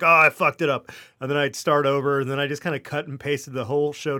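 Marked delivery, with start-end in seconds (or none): 0.0-0.9 loud, annoyed at his own mistake; frustrated outburst. Retold or imitated. Retelling how he snapped at himself mid-recording, loud and frustrated. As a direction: blurt it out, fed up with the botched take. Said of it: "be like".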